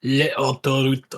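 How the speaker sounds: put-on hammy French accent on autoroute. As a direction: say it like a cheesy Frenchman. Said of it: "Can you say it in a French accent".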